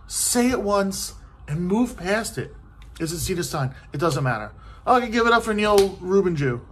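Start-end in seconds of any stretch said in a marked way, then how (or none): 4.9-6.6 announcer. Retold or imitated. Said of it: "host announcing mangled name".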